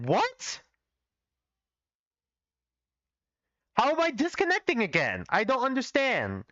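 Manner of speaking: real baffled frustration at the stream dropping; confused disbelief. Real, not acted. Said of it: "I genuinely am so confused".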